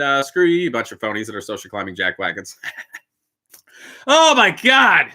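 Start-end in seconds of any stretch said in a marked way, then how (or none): 4.1-5.1 high, loud amused gasp; shocked at his own rant; laughing disbelief. Real, not acted.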